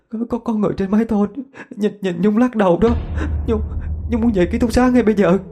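high-pitched, stammering panic; terrified girl. A narrator's reading. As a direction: stammer out what scared you, building to a frantic demand. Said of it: "Nhung mở mắt thều thào".

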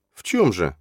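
usual speaking voice

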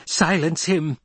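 high, sharp commanding cry; screamed. A narrator's reading. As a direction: scream an order, without full volume. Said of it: "the old woman screamed".

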